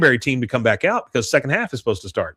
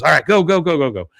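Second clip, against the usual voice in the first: pitched-up rallying call; urging them on; 4 semitones higher, faster than usual. Retold or imitated. Peggy sending girls onto the field, mock urging. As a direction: imitate a coach sending the squad out.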